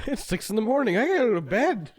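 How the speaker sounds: mock the friend in a squeaky voice; mocking high voice.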